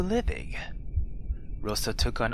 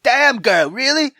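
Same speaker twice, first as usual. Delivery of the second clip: high, loud amazed tease; impressed disbelief; 11 semitones higher, 11 dB louder than usual. Real, not acted. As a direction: cheer her on in disbelief. Impressed reaction to Rosa.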